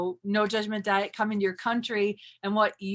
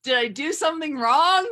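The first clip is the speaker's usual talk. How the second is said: mock worry; 3 semitones higher, 4 dB louder than usual. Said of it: "and you go".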